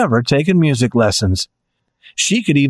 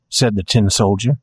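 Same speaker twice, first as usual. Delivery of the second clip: plain reading voice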